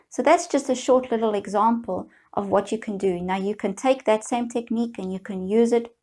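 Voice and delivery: normal talking voice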